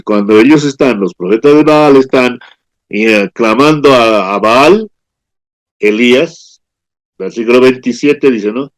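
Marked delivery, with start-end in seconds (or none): none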